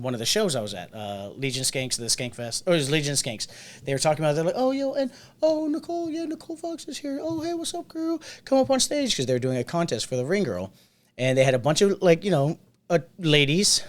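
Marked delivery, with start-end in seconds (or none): 4.6-9.2 high, excited hype-man voice, pitched way up; high squeaky mock announcer; mock host calling out. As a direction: call her up to the stage like an MC. Hosts calling Nicole up on stage.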